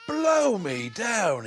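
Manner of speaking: drawn out in sheer astonishment; amazed. Acted. Bill lifts lid, finds baby.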